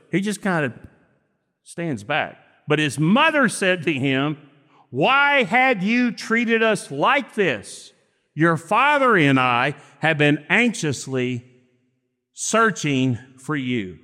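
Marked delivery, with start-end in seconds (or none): none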